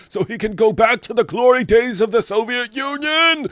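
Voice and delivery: loud, high sarcastic tirade; mock them, then hit back hard; heated sarcastic rant. Real, not acted. Mock-reads then sarcastic rebuttal.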